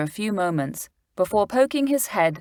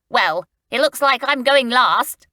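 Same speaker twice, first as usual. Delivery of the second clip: joking; 7 semitones higher, 6 dB louder than usual. A narrator's reading. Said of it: "joked Stan, giggling".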